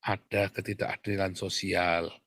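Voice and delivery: hushed, mock-measured understatement; mock euphemism. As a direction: imitate someone timidly softening the truth. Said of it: "Selalu ngomongnya begitu".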